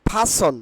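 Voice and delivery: "person" said PHA-son, r dropped; breathy p in "person", as pha-son; corrected pronunciation. Shown as a demonstration. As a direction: say "person" with puffed p and no r. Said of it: "correct pronunciation will be".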